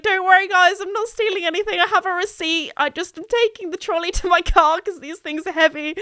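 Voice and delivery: high, rushed, anxious pleading; flustered reassurance. Retold or imitated. Pushing the beeping trolley past staring shoppers, anxious and high. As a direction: talk fast to convince everyone you're innocent.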